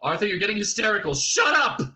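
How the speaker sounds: fast, high scolding into a yell; exasperated shout. A narrator's reading. Friend silencing a hysterical man, exasperated shout. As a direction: rush it, then shout the last two words. Ford tells hysterical Arthur off.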